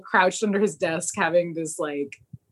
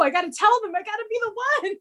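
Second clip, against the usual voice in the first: frantic; 13 semitones higher, much faster than usual. Retold or imitated. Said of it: "And he's like".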